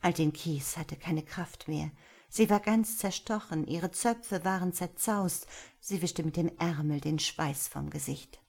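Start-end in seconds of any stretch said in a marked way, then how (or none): none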